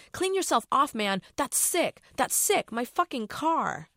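high, loud disgusted shouting, slowing at the end; disgusted yell. Acted. Driver shouting at the bloody friend about his car, high and loud. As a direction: shout it in revulsion.

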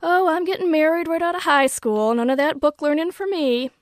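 put-on high, loud Southern belle accent; Scarlett O'Hara. A narrator's reading. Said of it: "talking like Scarlett O'Hara".

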